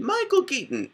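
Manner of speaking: MICHAEL KEATON in a very high squeal; different clone voice. Acted. Listing the actor's clones, "Michael Keaton" squeakily high. Same name for several clones.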